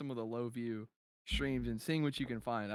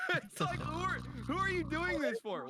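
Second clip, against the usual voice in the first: laughing disbelief. Real, not acted. Laughing incredulous high.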